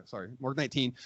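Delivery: usual speaking voice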